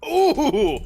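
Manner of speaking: high, loud drawn-out "ooh"; excited ooh. Real, not acted. Game master hearing of crushed hands, loud excited ooh. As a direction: react with a big high whoop.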